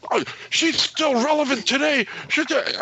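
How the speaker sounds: high mocking indignant voice; mocking agent voice. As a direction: mimic the agent, high and huffy. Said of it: "the guy just went off on me".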